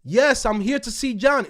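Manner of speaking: loud, firm insistence; forceful. Retold or imitated. To the receptionist, insisting loudly. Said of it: "So now I gotta talk like this".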